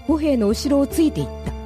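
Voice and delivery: ordinary delivery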